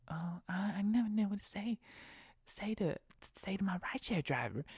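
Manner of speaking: mock a passenger who never knows what to say; clueless passenger.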